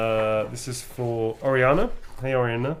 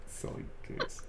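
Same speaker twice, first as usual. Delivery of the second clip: softer, whispered teasing; a hushed, teasing whisper; 2 semitones higher, 12 dB quieter than usual. Acted. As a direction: breathe it out softly. Whispered repeat of the joke.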